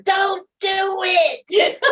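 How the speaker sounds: high, laughing mock warning; drawn-out playful "don't do it" in a high voice; imitating daughter. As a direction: echo the daughter through a laugh. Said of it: "That's my daughter out there".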